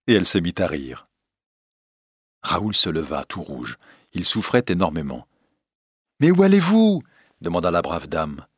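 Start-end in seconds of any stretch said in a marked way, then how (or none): 6.2-7.0 put-on high, loud startled old lady; shrieked question, much higher and louder; alarmed outcry. A narrator's reading. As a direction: call them back in surprise. Old lady stops departing visitor.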